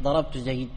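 ضربت زيد ended bare at zayd; wrong pausal form. Shown as a demonstration. Teacher repeating the mistaken form, cut short. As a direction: stop dead on زيد, no vowel. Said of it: "ما قلت".

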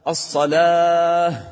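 slow chanted "الصلاة" with an audible h at the end; long "salaah" for "الصلاة"; clear final h. Shown as a demonstration. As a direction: hold the vowel of "الصلاة", then breathe out an h. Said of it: "بين الهاء".

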